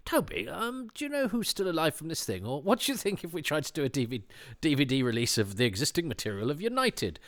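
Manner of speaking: a light impression of an eager producer; imitating Charles. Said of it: "it's normally Charles going".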